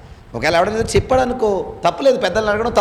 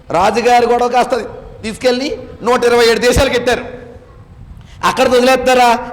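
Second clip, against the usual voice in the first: ordinary delivery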